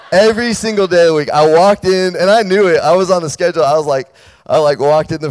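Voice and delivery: preacher gleefully telling the twist to students; excited. Real, not acted. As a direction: rush into the best part, thrilled. Gleeful build-up of story payoff.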